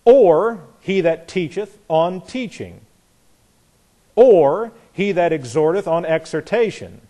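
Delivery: or hit firm at the start of each clause, slowed down; stress on or. Shown as a demonstration. Teacher showing the class how or repeats, punching or. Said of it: "emphasize the prepositions the way I did".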